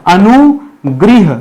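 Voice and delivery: अनुगृह stretched very slowly, anu-gri-h; teacher slowly sounding out अनुगृह; careful sounding out. Shown as a demonstration. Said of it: "क्या लगाएगा?".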